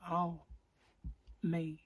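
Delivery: ordinary talk, nothing marked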